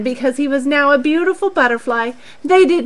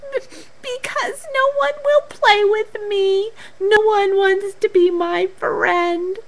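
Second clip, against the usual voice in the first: high, lightly voiced sad little caterpillar; a mild acted whimper, not real sobbing; crying Katy; 9 semitones higher than usual. Katy tells Charlie nobody will play, with a touch of tears. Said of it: "cried Katy".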